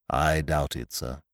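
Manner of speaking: low, soft, reserved servant; hushed, measured doubt; deferential valet. A narrator's reading. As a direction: demur discreetly, like a butler. Valet Jeeves gives his opinion.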